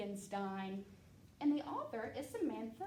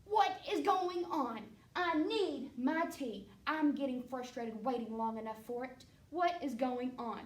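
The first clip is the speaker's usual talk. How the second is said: impatient king calling for his tea; frustrated king; 5 dB louder than usual. Acted. King barging into the kitchen demanding his tea, loud and impatient.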